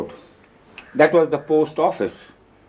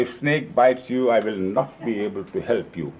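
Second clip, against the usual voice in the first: ordinary talk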